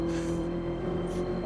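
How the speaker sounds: real amused laugh, breathy. Real, not acted. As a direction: laugh at the bad accent. Co-host laughs at the accent.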